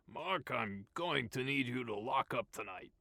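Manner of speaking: gruff boss. A narrator's reading. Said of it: "a gruff voice called him".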